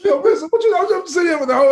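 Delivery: wound-up retelling, pitch jumping up; excited laughing outburst. Real, not acted. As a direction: burst in, cracking up at the memory. Excitedly recounting watching the movie.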